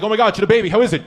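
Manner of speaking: excited caller. Retold or imitated. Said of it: "call the hospital like".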